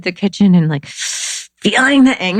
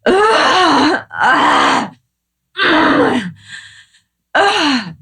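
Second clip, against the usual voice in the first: higher, hammed-up angry groans; play-acted angry grunts and sighs; mock frustrated groans; 10 semitones higher, much slower than usual. Shown as a demonstration. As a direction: groan it out through the body. Embodied anger shown in kitchen.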